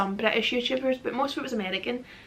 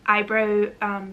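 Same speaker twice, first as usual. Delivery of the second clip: usual speaking voice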